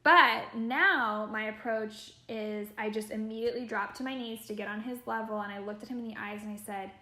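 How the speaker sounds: ordinary delivery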